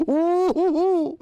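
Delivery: a high, loud, delighted ooh; excited ooh. Real, not acted. Reacting to a viewer.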